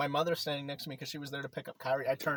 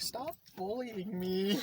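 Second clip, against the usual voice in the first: whiny imitation; 5 semitones higher, much slower than usual. Imitating friend's protest.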